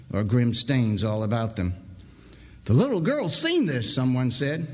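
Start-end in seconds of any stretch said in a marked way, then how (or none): 2.7-3.9 worried man. Acted. Worried miner's remark.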